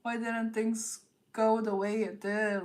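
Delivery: a lightly voiced moping ex; half-whined mopey imitation; mock sulky lament; mopey whine. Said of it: "somebody moping around, being like".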